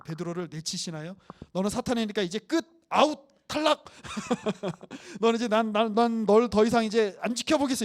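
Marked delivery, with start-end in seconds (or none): 1.5-7.8 loud mock dismissal, shouting out and fail; hammed-up judge throwing him out; playful, loud pretend rejection from Jesus. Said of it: "이러나요?".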